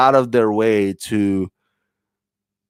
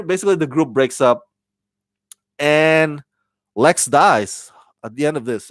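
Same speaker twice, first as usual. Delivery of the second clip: ordinary talk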